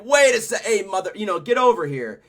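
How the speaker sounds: high and loud, calling after someone; mock cop call. Cop calling the walking-away singer back, loud and high. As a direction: yank him back with a shout.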